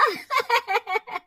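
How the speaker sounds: high scornful laugh; mocking laugh. Jealous Ritu laughing at Riya's play school plan. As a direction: laugh off her friend's idea.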